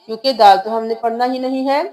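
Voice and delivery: normal talking voice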